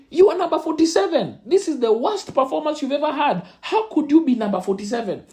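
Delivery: high, indignant fatherly scolding; lightly voiced dad berating the grade. Father at open day scolding his son over his rank, indignant.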